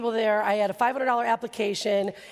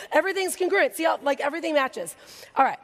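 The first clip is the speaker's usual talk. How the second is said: usual speaking voice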